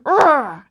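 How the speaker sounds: loud mock growl; angry growl. Shown as a demonstration. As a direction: growl like you're mad. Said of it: "make an angry sound".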